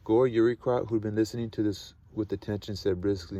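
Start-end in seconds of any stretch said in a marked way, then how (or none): none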